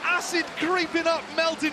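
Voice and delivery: high, excited play recap slowing down; esports caster. Real, not acted. Co-caster hypes the clutch.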